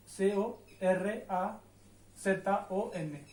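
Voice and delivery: very slow, letter by letter; teacher spells the word slowly; spelling out letters. Shown as a demonstration. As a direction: spell से ओ, एर आ with a pause after each letter. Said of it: "ये है कोरासोन".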